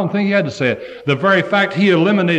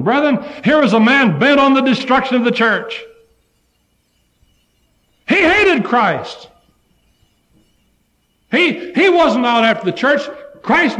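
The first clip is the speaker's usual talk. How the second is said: fiery preaching; 8 semitones higher than usual. Acted. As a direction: build the hatred into a cry. Preaching climax.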